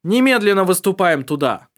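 loud commanding call; shouting. Acted. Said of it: "Предводитель крикнул своим людям".

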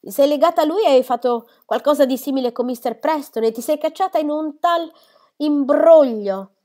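loud high rebuke, stretching out imbroglio; mother exploding in exasperation; exasperated mother. Acted. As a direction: lose patience with the daughter.

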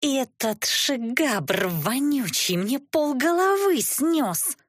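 high, drawn-out angry wail; outraged cursing. A narrator's reading. Injured sorceress cursing her attacker, outraged and high-pitched. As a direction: curse in pained outrage, pitch climbing high. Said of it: "стоны сменились проклятиями".

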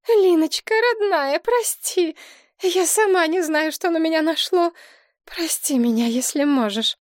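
high, loud, tearful plea for forgiveness; sobbing apology. A narrator's reading. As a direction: beg forgiveness through tears. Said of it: "она зарыдала и кинулась к дочери".

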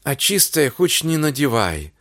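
ordinary delivery, nothing marked

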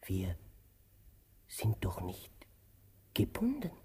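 very soft, whispered question; anxious hushed whisper. Acted. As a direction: ask it under your breath.

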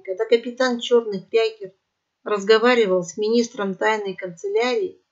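ordinary delivery

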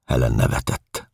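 ordinary delivery

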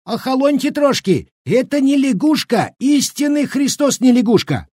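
put-on very high, flustered old man crying out; frightened protest. A narrator's reading. Scared old cook fending off the women, crying out. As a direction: insist frantically in a high voice. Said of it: "растерявшийся и перепуганный щукарь упорно выкрикивал".

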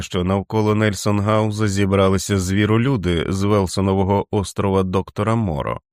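plain reading voice

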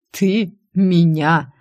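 slow, incredulous mockery; mocking. A narrator's reading. Boss taunting the secretary who threatened him, slow mockery. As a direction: draw it out as a taunt. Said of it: "с явной насмешкой спросил он".

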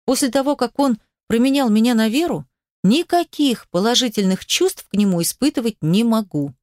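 ordinary delivery

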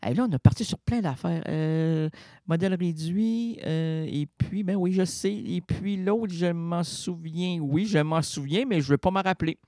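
normal talking voice

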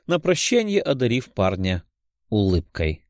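ordinary delivery, nothing marked

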